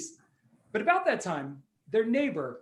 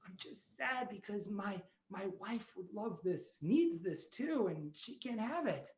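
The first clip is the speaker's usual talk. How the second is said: much softer, sorrowful confession; soft sad voice; 10 dB quieter than usual. Acted. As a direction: speak quietly, missing his wife. Old man sad about wife.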